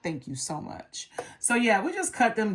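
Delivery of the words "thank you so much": whispered thanks. Retold or imitated. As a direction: breathe the thanks, no voice. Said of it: "I was like".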